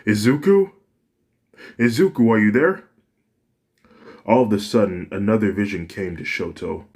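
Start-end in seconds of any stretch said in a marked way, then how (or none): 0.0-2.8 loud, high call into the dark. Acted. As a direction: call out across the field. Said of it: "He calls Izuku's name".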